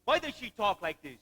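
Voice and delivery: ordinary talk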